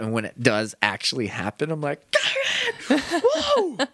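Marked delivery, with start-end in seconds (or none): 2.1-3.8 high startled shriek breaking into laughter; laughing scared squeal; startled yelp. Retold or imitated. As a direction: jump out of your seat at the scare. Jump scare reaction acted out.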